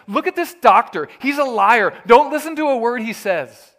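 loud, high, indignant shout; sick man shouting to the medical staff; angry accusation. Acted. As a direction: call the doctor out to the whole room. Sick man denounces doctor to staff.

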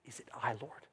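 very soft, low, searching question; hushed confession. Retold or imitated. Disciples in their heart of hearts ask Jesus, hushed. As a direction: ask it inwardly, barely voiced.

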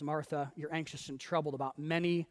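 soft, low, gentle correction; calm redirection. Acted. Jesus calmly redirecting Martha, soft and unhurried. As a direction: settle her down quietly. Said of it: "calmly redirects her".

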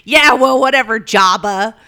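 loud, high scornful brush-off; patient sneering at the nurse, loud mocking retort; dismissive retort. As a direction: fling the insult back at the nurse. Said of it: "she goes".

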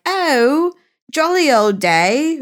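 high, drawn-out mock British; horrible British accent. Host mimicking guest whenever England comes up, horrible British accent. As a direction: imitate a friend's awful put-on British accent. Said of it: "put on this horrible accent".

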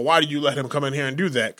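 usual speaking voice

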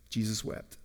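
very soft, low reading; grave, near-silent delivery; hushed and solemn. A narrator's reading. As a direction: let the verse drop almost to nothing. Solemn reading of Jesus's grief.

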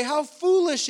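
"How foolish" called out loud and high, a shocked verdict; scornful exclaim. Retold or imitated. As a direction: blast "How foolish" like a judgment. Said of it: "we look at that and we say".

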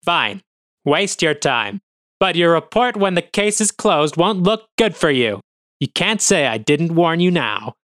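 parting threat. Acted. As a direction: throw it back as he leaves. Officer leaves with a warning.

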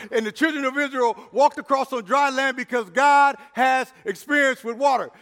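loud, building sermon climax; preaching at full force. Acted. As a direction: preach it, lifting the crowd.

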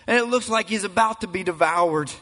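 preaching at full force. Acted. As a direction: climactic sermon build. Preacher builds to climax.

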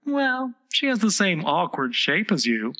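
high-pitched, mannered flower voice; prim Rose voice. A narrator's reading. The Rose explains manners to Alice, prim and high. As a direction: lecture primly, pitched high.

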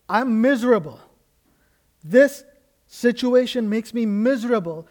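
rising to a loud, pleading outcry; anguished cry. Acted. Preacher voicing David crying out his misery to God. David's prayer voiced in sermon.

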